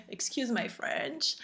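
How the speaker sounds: put-on slow, soft English with a thick French accent. Vlogger apologising for her accent in French-accented English. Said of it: "Désolée pour mon accent".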